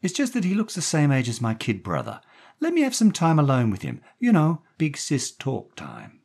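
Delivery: high, persuasive woman's voice; wheedle, slowing at the close; coaxing woman. A narrator's reading. Daphne asking Jerry for time alone with the boy, coaxing.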